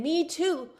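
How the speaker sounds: a gentle Jesus sharing the pain; Jesus consoling. Retold or imitated. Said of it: "Jesus saying".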